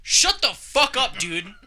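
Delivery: retold angry outburst, high and loud; slow, high-pitched, loud retelling of anger; mad outburst. Snapping back at a roommate over the TV, mad. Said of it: "I got fucking mad".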